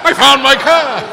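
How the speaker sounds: loud, high, slow laugh-through; laughing triumph. Retold or imitated. Finally finding his car in the lot, laughs loud and high. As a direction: burst out laughing with relief.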